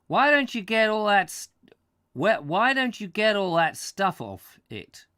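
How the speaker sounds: put-on light New England American accent, lively. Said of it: "the American talking".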